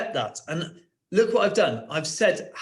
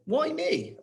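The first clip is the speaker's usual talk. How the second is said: high questioning; 12 semitones higher than usual. Shown as a demonstration. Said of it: "pigs asked a question".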